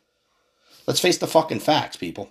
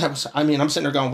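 usual speaking voice

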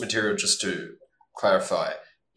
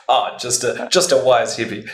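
amused relieved chuckle, louder; laughing; 4 semitones higher, 8 dB louder than usual. Real, not acted. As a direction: laugh through the words. Relieved laughter at own ignorance.

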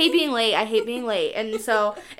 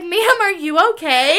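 laughing through the quote; 7 semitones higher, 9 dB louder than usual. Retold or imitated. Quoting the confused stranger.

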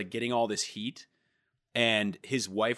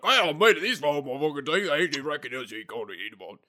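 mock British mumble; 4 semitones higher, faster than usual. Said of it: "And they're like".

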